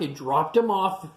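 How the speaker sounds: ordinary delivery, nothing marked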